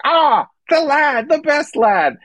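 drawn-out, exaggerated British "the best lad"; British press accent. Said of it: "They're like".

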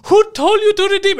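very high, mock-frantic outrage; hammed-up scammer shriek, rising in force; scammer losing it. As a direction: imitate someone losing their mind. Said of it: "they're losing their fucking minds".

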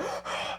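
soft, strained intake of air; struggling breath. Shown as a demonstration. Vacuum-wrapped against the wall, struggling breath. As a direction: suck air through a tiny gap.

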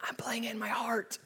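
whispered excuse; mock whisper of a lame excuse. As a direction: breathe it as a sheepish secret. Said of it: "your response is".

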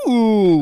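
loud high mew; kitten mew. Pretend. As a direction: try a cat noise. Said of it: "That was bad".

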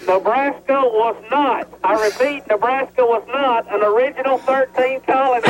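slow, drawn out bullhorn call; bullhorn announcement. Real, not acted. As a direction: announce it slowly over a loudspeaker. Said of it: "We're enjoying the bullhorn right now".

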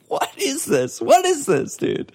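very high squeal of disbelief; retold incredulity. Host re-enacts watching the goal go in, retold incredulity. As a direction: squeak it out, baffled and aghast. Acted reaction to absurd goal.

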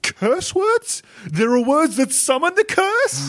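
high alarmed yelp of a question. Acted. Reporter alarmed.

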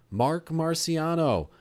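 high, loud, drawn-out name call; imitated yell. Confused lawyer calling her client, imitated yell. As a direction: call the name slowly across the room. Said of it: "she yells out".

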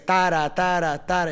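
chanted "tara, tara, tara", each TA accented; musical rhythm. Shown as a demonstration. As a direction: beat out the pattern with "tara". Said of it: "esa es la musicalidad". ("tara, tara" rítmico, acentuando cada TA)

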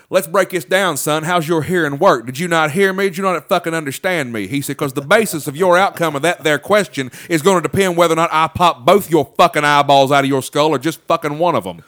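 a hint of Boyd Crowder, loud and menacing; Boyd Crowder impression. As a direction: imitate a cold southern tough guy threatening. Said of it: "he almost sounded like Boyd Crowder".

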